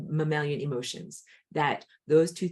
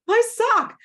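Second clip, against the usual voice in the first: put-on high, whiny little-kid voice, much louder; whiny toddler; 10 dB louder than usual. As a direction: pout it out like a crying three-year-old.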